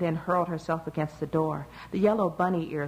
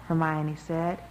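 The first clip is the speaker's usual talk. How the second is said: plain reading voice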